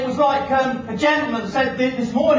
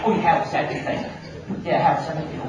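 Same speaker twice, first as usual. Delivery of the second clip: low, offhand, toneless agreement; lip-service platitude; 8 semitones lower, 4 dB quieter than usual. Retold or imitated. Audience repeating a platitude about health, low and offhand. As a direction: drop right down and toss it away. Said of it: "No, we say".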